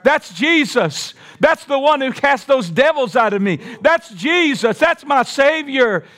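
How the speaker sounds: high, fervent exclamations, building; preacher acting out an overjoyed follower; joyful recognition. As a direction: cry it out as the voice is recognised. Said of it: "I believe she said".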